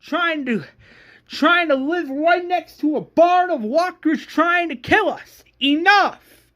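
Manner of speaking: high, loud, furious rant; screamed tirade. Acted. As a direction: scream a rallying demand at the group. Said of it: "that's where Shane screams".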